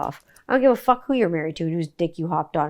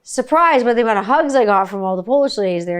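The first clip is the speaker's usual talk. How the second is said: normal talking voice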